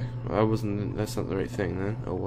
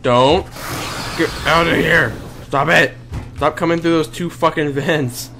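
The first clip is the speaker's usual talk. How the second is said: loud frustrated shouting; angry yelling; 5 semitones higher, 7 dB louder than usual. Real, not acted. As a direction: yell it in scared anger. Monster keeps coming through vents.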